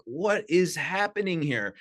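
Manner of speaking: drawn-out puzzled awe at strange sounds; a touch of mock bewilderment; bewildered. Hearing gongs for the first time.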